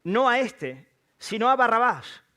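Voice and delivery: gritos de multitud. A narrator's reading. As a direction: call it out loudly as the crowd's demand. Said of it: "todos dieron voces de nuevo". (clamor de la multitud)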